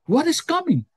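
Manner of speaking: high-pitched, puzzled question; retold protest, voice shooting up; baffled. Told of what's coming, questions the man, baffled. Retold surprised reply to a prophecy.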